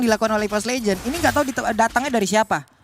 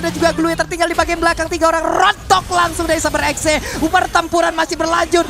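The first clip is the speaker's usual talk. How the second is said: esports caster; 3 semitones higher, 4 dB louder than usual. Real, not acted. Live play-by-play of final fight.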